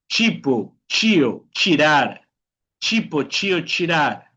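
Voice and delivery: "chipo, chio, chirar" each opening on ch. Shown as a demonstration. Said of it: "T más I". ("chipo, chio, chirar" con la T como ch)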